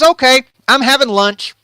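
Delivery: loud, high announcement; abrupt cheerful dismissal. Acted. David abruptly drops his mourning.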